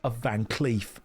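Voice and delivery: light mock Cockney British accent; a hint of a London geezer's British accent; Danny Dyer. A streamer narrating his game to chat, light mock Cockney British accent. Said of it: "Danny Dyer impression stream".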